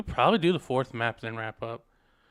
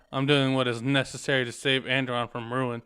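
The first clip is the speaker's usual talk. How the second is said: normal talking voice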